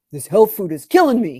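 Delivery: a hint of a grumpy dad; dad complaining.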